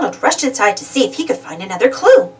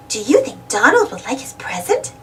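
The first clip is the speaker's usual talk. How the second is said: ordinary delivery, nothing marked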